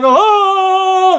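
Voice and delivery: high, loud wail of protest; retold panicked wail. Answering the wives who say calm down, panicked wail.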